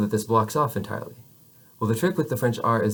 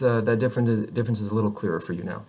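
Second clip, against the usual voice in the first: ordinary delivery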